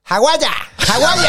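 loud, high Australian greeting; best Australian accent. Shown as a demonstration. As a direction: shout it in a hammed-up Aussie accent. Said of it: "do your best Australian accent".

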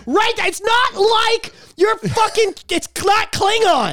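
incredulous, half laughing, pitch way up; stunned disbelief at the idea; exasperated laughing rant. Real, not acted. Exasperated rant rising loud.

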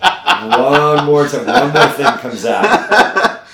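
slow, loud laughing repeat. Real, not acted. Teasing the cohost about his ideas, laughing loudly and slowly. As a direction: laugh through the words, drawn out.

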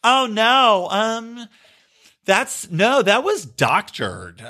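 high, slowed mock denial; mocking Ocasio-Cortez. Host mocking Ocasio-Cortez's denial, high and slow. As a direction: whine the excuse, dragging the last words. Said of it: "Ocasio-Cortez says".

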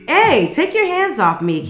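sharp outraged yelp; indignant protest. Acted. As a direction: shake off the bouncer's hands. Kiyazaki pushes bouncer off.

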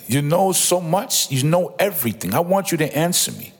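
mock exasperation, daring them to answer; a touch of an impatient, challenging God; frustrated God. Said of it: "he's getting frustrated".

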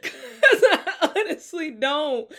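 a real laugh at her own lack of answers; laughing outburst. Real, not acted. Admitting to viewers she has no answer, laughing loud and high. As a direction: burst into a high, loud laugh, then slow down.

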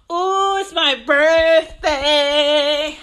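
put-on a kid thrilled it's her birthday month; excited child.

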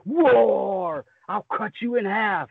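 high-pitched roaring lion hero; roar, then threaten as the lion; Leon the Lion. Acted. Showing off the lion Ranger, high roaring battle voice. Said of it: "like Leon, the lion. He's like".

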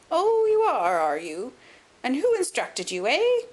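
put-on high, loud, swooping old voice; fussy challenge, leaping high at the ends; querulous old man. A narrator's reading. Old man questioning who hired the detective, querulous and high. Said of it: "a querulous voice".

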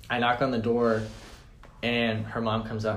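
ordinary talk, nothing marked

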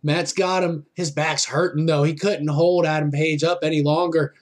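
lively live play-by-play calling; wrestling commentator. Real, not acted. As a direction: call the match as it happens.

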